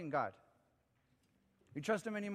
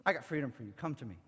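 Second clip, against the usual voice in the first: lowered, gentle invitation; Jesus; faster than usual. Acted. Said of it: "Listen to the voice of Jesus".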